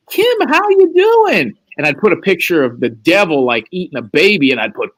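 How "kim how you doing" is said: high, slow mock greeting; high drawn-out fake-friendly hello; mock caller greeting. Callers greeting him as Kim.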